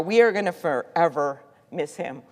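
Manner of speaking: ordinary talk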